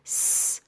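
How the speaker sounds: س as a voiceless "sss"; sound of S. Shown as a demonstration. Teacher likening S to Arabic seen, isolated hiss. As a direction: hiss س alone, no vowel. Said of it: "زي حرف السين".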